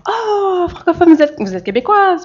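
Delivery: high-pitched gushing imitation; excited stranger. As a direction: mimic people exclaiming over an accent, pitch leaping up. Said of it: "les gens entendent mon accent, ils sont".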